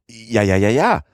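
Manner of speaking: high, urgent rapid-fire insistence; frantic servant bursting out in agreement; excited cry. A narrator's reading. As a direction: cry out the repeated yes, rising. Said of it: "rief Passepartout".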